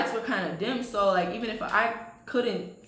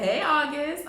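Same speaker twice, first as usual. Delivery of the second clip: put-on higher greeting in a country accent; 7 semitones higher, slower than usual. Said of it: "in my country accent".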